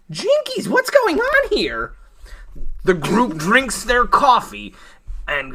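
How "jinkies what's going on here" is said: very high cartoon-sleuth alarm; startled Velma. Velma reacts to Elaine's bloody confession, startled. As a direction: squeak it out, startled.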